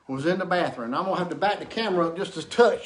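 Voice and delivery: ordinary talk, nothing marked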